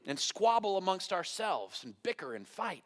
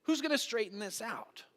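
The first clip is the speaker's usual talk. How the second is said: higher-pitched acted call to action; bold challenge; 7 semitones higher than usual. Believer standing up for truth, bold challenge. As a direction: stand up and demand a volunteer. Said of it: "willing to stand up and say".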